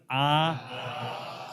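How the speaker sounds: exaggerated "Ah." in a wide open mouth; big ah. Shown as a demonstration. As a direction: drop the jaw for a big "Ah.". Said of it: "make a big ah".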